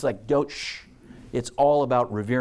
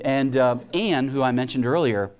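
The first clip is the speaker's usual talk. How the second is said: ordinary talk, nothing marked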